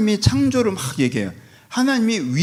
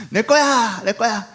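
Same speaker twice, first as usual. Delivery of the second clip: put-on high, childish "it's mine" repeated; a hint of a sulky child clinging on; mock whiny possessive; 6 semitones higher, much slower than usual. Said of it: "이런 스타일".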